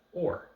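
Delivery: "or" punched high and loud; stress on OR. Shown as a demonstration. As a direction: hit the word "or" as the alternative. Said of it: "or the word".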